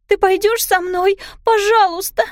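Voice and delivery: put-on high, loud begging child's voice; a frightened child begging her stepmother to stay; pleading girl. A narrator's reading. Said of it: "умоляла Пиппа". (высокий громкий умоляющий детский голос)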